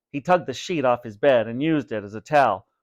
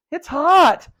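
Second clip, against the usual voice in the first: high, loud shriek; 9 dB louder than usual. A narrator's reading. Vinny burned by the goo, high loud shriek. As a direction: yelp it out in pain. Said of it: "shrieked Vinny".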